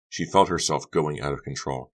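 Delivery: ordinary delivery, nothing marked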